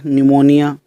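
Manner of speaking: न्यूमोनिया said starting with n, p silent; silent p said right. Shown as a demonstration. Teacher showing students the correct form, p silent. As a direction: drop the p in न्यूमोनिया. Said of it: "इसको पन्यूमोनिया नहीं बोलते".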